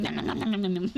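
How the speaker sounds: rapid-fire cartoon nom-noms; playful munching noises. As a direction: gobble it up in pretend, giggling. Pretends to gobble the drawn lines.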